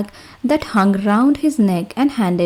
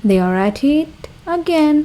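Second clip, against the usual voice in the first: shouted; 7 semitones higher than usual. A narrator's reading. Messenger shouting the news into the king's ear, mock shout. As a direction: call it out like a herald. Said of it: "shouted at the top of his voice".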